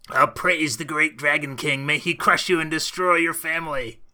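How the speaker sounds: rally the tribe to the dragon king, kobold style. Acted. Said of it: "In your best kobold voice".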